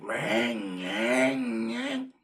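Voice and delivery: rising vroom of a revving truck engine, mimicked with the mouth; engine revving imitation. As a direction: mimic a truck gunning its motor, building up.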